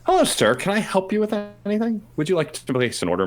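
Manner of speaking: shrill-high sarcastic welcome; mock-sweet politeness. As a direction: kill him with courtesy. Bartender turns on pushy Griff.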